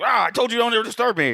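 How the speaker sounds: high, fast, angry snap; angry outburst. Retold or imitated. Retelling the doctor's angry outburst.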